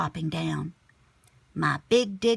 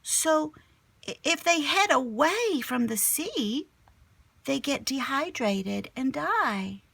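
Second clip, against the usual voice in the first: put-on kid explaining; 7 semitones higher than usual.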